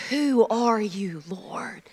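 slow, hushed southern drawl; low southern accent, fading away. Acted. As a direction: draw it out softly, southern-style. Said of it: "Saul has a southern accent".

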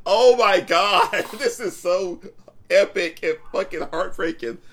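loud, high, overwhelmed fan gushing; retold amazement. Fan reliving Harry's rescue for a friend, amazed. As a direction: start with a long, loud gasp of awe, then keep gushing.

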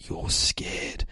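put-on slow, eerie child-villain whisper; whispering slowly into their soul; creepy whisper. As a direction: draw it out in a spooky hush. Voices creepy possessed-looking child.

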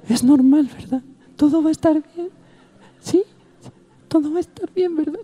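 vocecita muy aguda de pasajera angustiada; vocecita de señora imitada. Retold or imitated. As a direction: imita a una señora que pide que la calmen. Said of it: "yo lo único que oía". (falsete de señora preocupada)

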